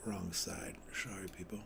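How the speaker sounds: a soft aside, whispered off-mic; whispered apology to viewers. Real, not acted. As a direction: hush it like a muttered slip-up. Fumbling the chart mid-stream.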